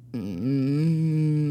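long, high humming moan; drawn-out "mmm" imitating someone's weird moaning; imitated weird moan. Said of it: "A bunch of weird moans".

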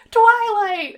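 high, loud call of Twilight; high-pitched holler from the doorway. A narrator's reading. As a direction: call out urgently for a friend as you approach. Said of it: "it called".

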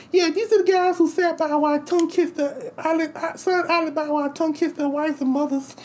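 high squeaky lisping Tyson voice, way up; Mike Tyson impression. Tyson doing his monologue to the crowd, in a high squeaky voice. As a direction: mimic Tyson's high voice hard. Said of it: "So Mike Tyson comes out".

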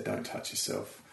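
low, whispered mock warning; hushed warning. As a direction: breathe it like a stern secret. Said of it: "they said".

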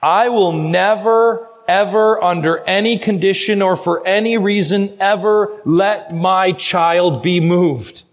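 preacher voicing God's solemn oath; God's emphatic promise. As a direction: declare an unbreakable promise, stressing each absolute. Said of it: "God is saying this".

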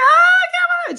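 high, loud happy wail; imitated overwhelmed cry of joy; good cry. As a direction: wail it out, overjoyed. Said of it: "She's crying, but good cry".

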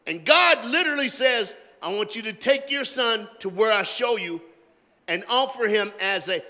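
loud, high preacher's reading; full-force preaching. Acted. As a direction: preach God's command with fire.

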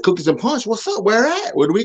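put-on high, excited kid's questions; imitating his eager younger self. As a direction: jump at the offer of treats. Eager kid voiced in story.

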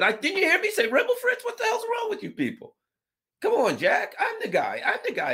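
squeaky indignant geezer; cranky old man. Retold or imitated. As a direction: whine like a testy old man who's been misheard. Biden impression, indignant.

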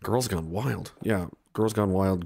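usual speaking voice

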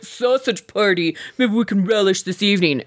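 lightly voiced smug guy savoring his pun; mocking the contestant. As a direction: ham up a sleazy meat-pun line. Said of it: "and he's like".

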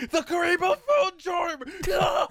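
high, loud hammed-up wail; mock anguished cry. Friend mourning the broken phone charm, hammed-up shriek. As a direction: wail over the lost charm like a cartoon. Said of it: "I'm like".

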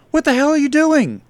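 high, loud alarmed shout; yell. Acted. Front desk girl confronts the attacker, yelling. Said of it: "Lily yelled".

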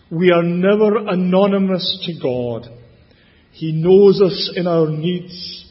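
normal talking voice

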